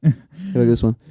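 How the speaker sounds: loud mock call for attention; pushy imitation. Imitates people pushing their videos.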